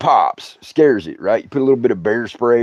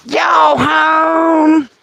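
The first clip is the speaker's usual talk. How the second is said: Martha; 13 semitones higher than usual. Acted. Annoying neighbor calling to a sleeping couple, high holler. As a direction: holler it like an uninvited, maddening neighbor. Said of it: "You've heard me do the voice".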